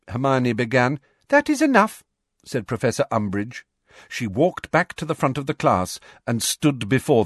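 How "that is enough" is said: high, loud, sharp reprimand; sharp cutoff. A narrator's reading. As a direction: snap it shut on her. Umbridge cuts her off.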